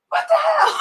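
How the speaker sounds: high, loud shocked cry; shocked outburst. Retold or imitated. Mom seeing her daughter's tattoos, high shocked cry. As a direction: react with sudden shock.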